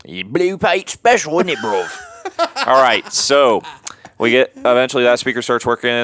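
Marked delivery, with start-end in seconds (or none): 0.0-1.9 mock British accent. Bandmate naming a radio show as a joke, high mock British. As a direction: play a squeaky London lad. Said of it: "There he is doing British again".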